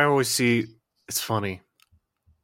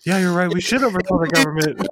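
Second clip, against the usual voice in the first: higher, louder fake-sweet agreeing voice; 8 semitones higher, 4 dB louder than usual. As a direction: play a bait girl luring him in. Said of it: "honeypots your ass, being like".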